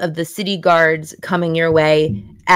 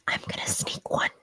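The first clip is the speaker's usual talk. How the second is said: secret whisper; 16 dB quieter than usual. Acted. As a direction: breathe it like a secret. Boy confiding a secret.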